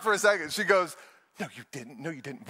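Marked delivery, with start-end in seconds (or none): 1.4-2.5 very soft, low contradiction; hushed disbelief. Retold or imitated. Wife doubting husband's fib in bed, hushed disbelief. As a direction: imitate a wife not buying it.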